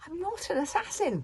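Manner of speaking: pleading quaver. A narrator's reading. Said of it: "pleaded Hiccup, um, quaveringly".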